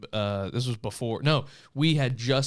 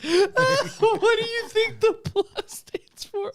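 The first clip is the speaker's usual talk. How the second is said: high, loud laughter through the words; almost crying with laughter; laughing hard; 7 dB louder, slower than usual. Real, not acted. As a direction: crack up while echoing the question. Laughing while quoting the joke.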